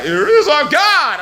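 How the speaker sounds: high, drawn-out believer's cry; hammed-up devout declaring; mock proclamation. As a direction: mimic everybody proclaiming, slow and high. Mimicking what everybody proclaims.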